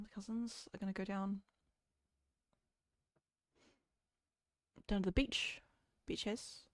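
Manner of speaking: soft, hesitant broad New Zealand accent; softly put-on Kiwi accent; thicker Kiwi accent. Shown as a demonstration. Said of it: "can you make your accent thicker after this".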